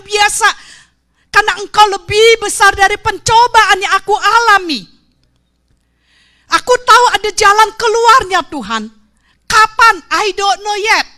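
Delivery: loud, high, pleading prayer; fervent prayer. Acted. Preacher reenacting her prayer to God, fervent loud prayer. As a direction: cry out to God in faith.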